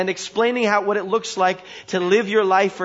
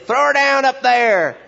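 high, loud country-preacher holler; acted shout; 7 semitones higher, 7 dB louder than usual. Man ordering Jezebel thrown down, mock-dramatic shout. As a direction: call up to a high window, hammed-up. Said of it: "And he said".

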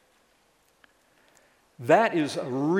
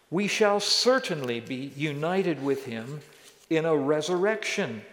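raised, louder, forceful public reading of scripture; 3 semitones higher, 4 dB louder than usual. Real, not acted. Pastor reading Romans six to the church, proclaiming. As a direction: proclaim the passage to the room.

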